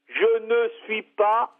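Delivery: "Je ne suis pas" slowly, word by word; exasperated emphasis. Real, not acted. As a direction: spell out "Je ne suis pas" with slow, insistent spacing. Corrects caller who keeps mistaking him.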